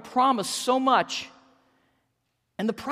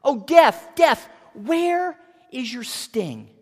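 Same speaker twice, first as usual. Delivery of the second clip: loud taunt; 4 semitones higher, 9 dB louder than usual. Acted. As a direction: jeer at a defeated enemy, full voice. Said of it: "he taunts with these words".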